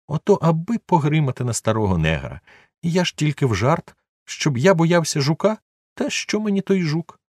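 high, sheepish servant excusing himself; Jupiter's voice. A narrator's reading. Old servant brushes off fear of the beetle, high and sheepish. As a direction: pitch up as the scolded old servant.

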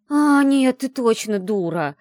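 high, loud cry of disbelief; exasperated exclamation. A narrator's reading. Friend scolding the heroine as a fool, loud exclamation. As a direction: exclaim it, drawing out the insult slowly. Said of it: "воскликнула Яла".

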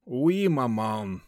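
slow sighing reply. Acted. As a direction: sigh it out, resigned. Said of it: "со вздохом ответил веник".

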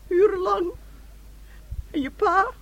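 crying through the word; tearful. Acted. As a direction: voice breaking with tears. Mother recalls crying at hospital. (huilend, met brekende stem)

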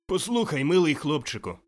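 high, loud, friendly hail; calling out. A narrator's reading. Scrooge at the window to the boy, calling out. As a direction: call down eagerly to get attention.

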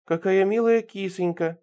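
high, slow, syrupy cooing; cooing baby talk. A narrator's reading. Ирина admiring the cat, high cooing baby talk. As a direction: lisp and coo as if to a kitty. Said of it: "просюсюкала Ирина".

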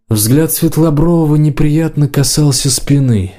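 ordinary delivery, nothing marked

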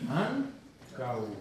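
Ancau slowly as an-cau; two syllables. Shown as a demonstration. Teacher modelling Ancau for the class, two careful syllables. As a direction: hold AN, pause, finish on a single CAU. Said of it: "Dos sílabas".

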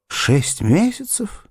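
slow, rising question, pitched high; horrified disbelief. A narrator's reading. Suitor repeating the doctor's deadline, in horrified disbelief. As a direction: echo it aghast, drawing it out. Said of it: "Сэмюэль в ужасе смотрел".

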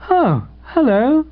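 high, singsong hello; cheery greeting. Acted. Radiant man breaks off his song to greet his friend, cheerily. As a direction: greet with a lilting, drawn-out hello.

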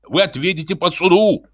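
high-pitched official warning; worked-up scolding; indignant threat. A narrator's reading. Manager threatens court.

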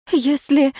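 high, shaky start; trembling voice. A narrator's reading. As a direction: voice trembling with nerves. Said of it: "дрожащим голосом заговорила она".

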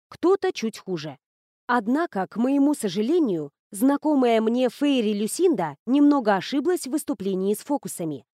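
ordinary delivery

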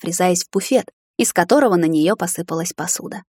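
ordinary delivery

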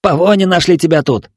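loud, high angry jeer; angry taunt. Acted. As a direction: spit the taunt out. Bloodied boy taunts Ayka.

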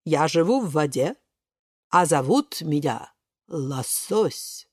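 low, measured, slowing fish voice; Лосось. A narrator's reading. Salmon introducing himself to the swimmers, low and slow. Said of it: "говорит незнакомец".

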